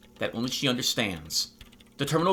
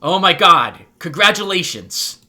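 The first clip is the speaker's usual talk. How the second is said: loud, high-pitched delight; excited congratulations; 4 semitones higher, 12 dB louder than usual. Acted. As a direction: burst out congratulating a friend. Congratulating her on the discovery.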